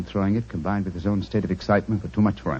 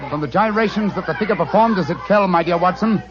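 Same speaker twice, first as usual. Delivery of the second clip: louder, rallying call over the crowd; 11 semitones higher, 7 dB louder than usual. Acted. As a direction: shout over the bonfire noise. Holmes calls over bonfire crowd.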